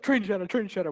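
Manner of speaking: imitating a high, loud game callout; excited callout. Player imitating a teammate calling the play, excited. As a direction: call it out high and urgent, as retold.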